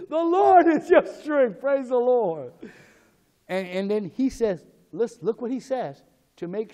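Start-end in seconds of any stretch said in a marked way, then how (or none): 0.1-2.5 high, loud, drawn-out proclamation; exultant preaching shout. Real, not acted. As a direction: shout praise to the congregation. Preacher exclaims amid laughter.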